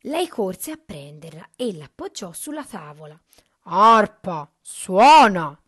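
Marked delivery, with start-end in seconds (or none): 3.6-5.6 very loud, booming order; ogre's command. A narrator's reading. Ogre commands the magic harp, booming. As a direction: bark it out as a giant's command. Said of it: "comandò Gianni".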